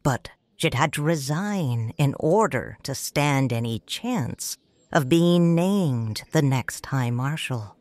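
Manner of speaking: ordinary delivery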